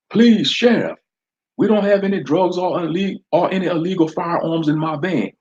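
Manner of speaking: pleading. Acted. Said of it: "D J Charlie Smooth pleaded".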